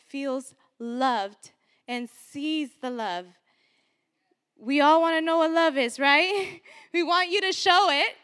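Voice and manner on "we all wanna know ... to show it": louder, higher and amused; laughing aside. Real, not acted. Woman preacher ribbing the men in church, laughing. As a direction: say it loudly through a laugh.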